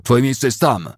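high, loud pointed command; shout. A narrator's reading. Officer sending a soldier to the lines, shouting. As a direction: order him back to the front. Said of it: "krzyknął".

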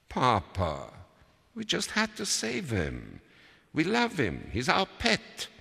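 put-on very high child's plea; pleading child. A narrator's reading. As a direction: justify it desperately to father.

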